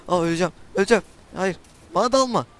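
panicked. Real, not acted. About to die in game.

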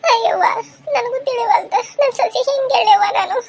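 high, tearful wail; acted crying. Mother at a loss how to tell Meena, wailing. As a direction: lament helplessly, pitched right up. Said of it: "ನೀನ್ ಯಾಕೆ ಇಷ್ಟ ಪರಿ ಅಳಾತ್ರಿ?".

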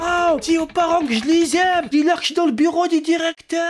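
hammed-up high wail to little brother; action-film farewell. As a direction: call out heroic last words while dragged away. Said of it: "comme dans un film d'action".

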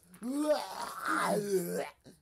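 very loud, high animal bellow; hammed-up death cry; dying deer cry. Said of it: "The deer goes".

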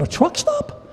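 very high, squeaky disbelief; incredulous. Retold or imitated. Preacher echoing the girl again, in high squeaky disbelief. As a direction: squeal it in mock shock.

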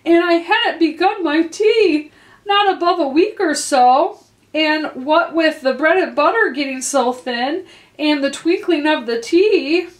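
high, pleading nervous rush; trembling voice. A narrator's reading. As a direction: babble on trembling with fear. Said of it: "in a trembling voice".

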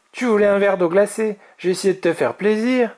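high-pitched playful taunt; cheeky young dragon, voice up; teasing. A narrator's reading. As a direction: tease the loser cheekily. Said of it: "le taquina-t-il".

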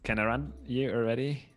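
usual speaking voice

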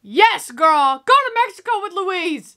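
root for her out loud; excited cheer for Thelma to go. Real, not acted. Cheering the character on.

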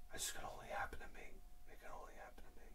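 whisper. Real, not acted. Self-pity, whispered.

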